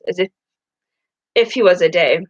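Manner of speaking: usual speaking voice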